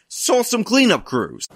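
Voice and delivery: imitating a newswoman's sarcasm; mock sarcasm. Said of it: "I love this woman's sarcasm".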